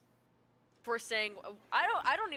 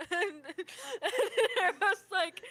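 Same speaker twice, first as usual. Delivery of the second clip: laughing; 7 semitones higher, 4 dB louder, much slower than usual. Real, not acted. Laughing while retelling mockery.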